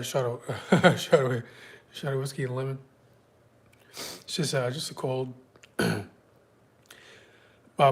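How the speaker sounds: ordinary talk, nothing marked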